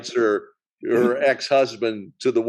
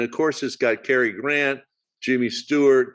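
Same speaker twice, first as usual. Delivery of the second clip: usual speaking voice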